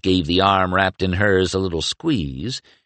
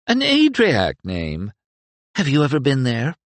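Mira voice; 8 semitones higher than usual. A narrator's reading. Mira excited flirt.

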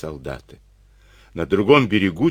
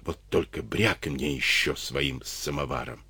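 low, hushed hissing rebuke; soft hissed warning to keep quiet; angry hiss; 4 semitones lower, 8 dB quieter than usual. A narrator's reading. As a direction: scold him under your breath, low and soft. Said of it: "прошипел он".

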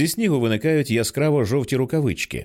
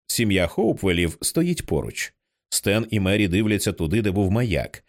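plain reading voice

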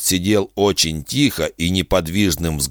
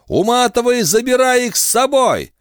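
high, loud enraged yell; furious shout; 3 dB louder than usual. Acted. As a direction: scream it out in fury. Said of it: "подхваченная потоком ярости".

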